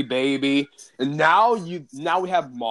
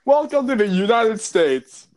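higher, louder mock announcement; announcer proclamation; 8 semitones higher, 6 dB louder than usual. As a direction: proclaim it like a game host. Repeated as proclamation.

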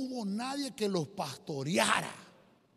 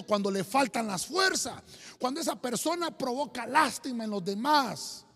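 fervent preaching; 5 dB louder than usual. Acted. Preaching with rising force.

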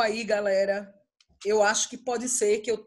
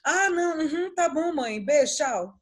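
put-on breezy kid on the phone; 5 semitones higher, slower than usual. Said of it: "eu ligava".

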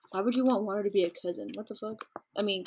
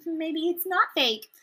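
high, slow wistful hoping; dreamy hopeful fan; 7 semitones higher, slower than usual. Retold or imitated. Playing a smitten teen fan, slow and high, hoping. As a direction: drag out the hope, NOT leaned on. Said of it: "I get why you would be like".

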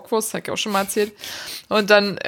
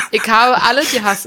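ordinary delivery

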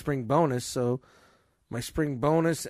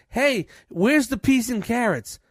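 high, slow whiny customer; complaining customer impression; mock customer; 6 semitones higher, slower than usual. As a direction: mimic a demanding shopper. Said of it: "customer voiced in rant".

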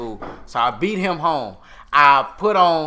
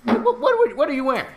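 shocked dad; 9 semitones higher, faster than usual. Retold or imitated. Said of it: "He say".

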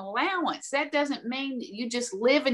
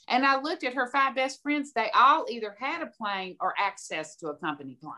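usual speaking voice

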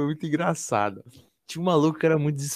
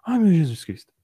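imitating someone about to faint, higher and louder; near-fainting; 5 semitones higher, 6 dB louder than usual. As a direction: gasp it as if overwhelmed. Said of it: "o maluco inquieto quase desmaiou". (imitando alguém quase desmaiando)

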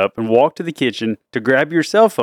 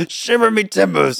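high, hammed-up pirate; mock pirate exclamation; 7 semitones higher than usual. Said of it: "you sound like a pirate".

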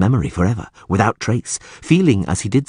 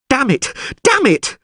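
hollering. A narrator's reading. Dandelion hollers from behind the wall, frantic. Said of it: "hollered Dandelion".

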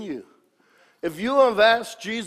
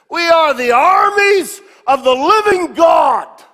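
loud, high, shouting preacher; preaching at full force; 10 semitones higher, 12 dB louder than usual. Acted. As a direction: build it to a roar.

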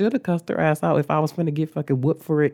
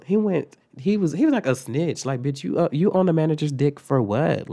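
ordinary delivery

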